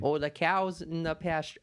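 mock rural Minnesota accent. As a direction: Minnesota accent, hammed up lightly. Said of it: "What is it, like".

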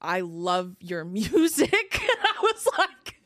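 laughing through the retold quote; real laughter while retelling. Real, not acted. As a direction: break into giggles.

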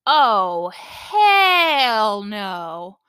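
put-on loud, drawn-out child's outburst; belted out. Son blurting out in a silent movie theater, loud drawn outburst. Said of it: "belts out".

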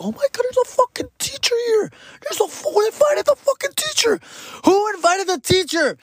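very high, squealing imitation of a panicked girl; mock embarrassed girl. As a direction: mimic her mortified outcry in falsetto. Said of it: "she's, like, all embarrassed, and she ran inside".

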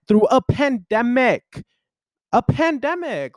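pandemic stretched high and slow; a pandemic, said with disbelief; exasperated emphasis. Real, not acted. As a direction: lean hard on pandemic, incredulous.

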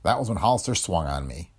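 usual speaking voice

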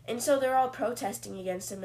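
normal talking voice

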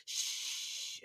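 soft hushed shh; whispered shush. Pretend. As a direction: hush them gently. Hushing a sleepwalker.

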